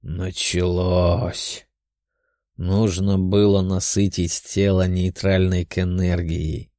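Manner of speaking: low, slow, measured demon voice; demon's inner voice. A narrator's reading. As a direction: think it through slowly in a low voice. Demon Theo thinking aloud.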